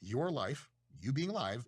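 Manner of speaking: normal talking voice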